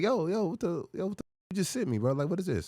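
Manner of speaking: high-pitched, hurried protest, retold; mildly mad, words tumbling out high. Calling his friend about the text, retold anger. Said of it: "I call him mad".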